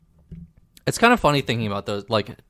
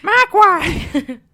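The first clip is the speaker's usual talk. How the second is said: loud, very high creature squeal; mogwai voice; 8 dB louder than usual. Pretend. Joking to co-host as a mogwai, high squeaky cry.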